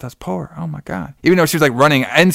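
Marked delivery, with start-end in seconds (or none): 0.0-1.1 soft, half-hearted mock dismay; casual talking voice. Mocking the fleeing wife's poor acting, soft and casual. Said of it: "like I'm talking to you now".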